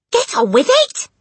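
high, loud, impatient screech; urgent hissed order. A narrator's reading. Mother goads timid husband, shrill and impatient. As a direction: screech him into action. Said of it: "hissed the mother".

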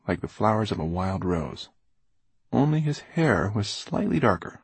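ordinary delivery

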